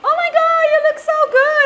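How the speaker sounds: mock-gushing partygoer at a party. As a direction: gush over the outfit like a stranger.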